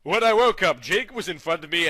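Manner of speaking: usual speaking voice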